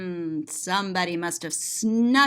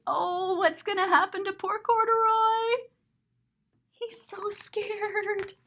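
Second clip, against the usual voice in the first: tender sympathy; 9 semitones higher than usual. Acted. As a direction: feel for the scared bear. Sympathy for frightened bear.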